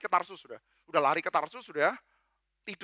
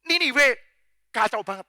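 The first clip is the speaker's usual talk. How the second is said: lightly acted, higher-pitched wrathful God; animated divine complaint, pitched up; God addressing Jonah; 5 semitones higher, 4 dB louder than usual. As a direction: rant about Nineveh in colloquial outrage. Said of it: "Dia bilang".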